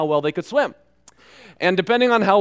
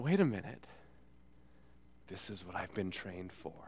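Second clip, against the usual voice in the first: soft, low inner thought; quiet realization; 8 semitones lower, 19 dB quieter than usual. Retold or imitated. Lifeguard realizes his moment, very softly. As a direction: murmur it as dawning resolve.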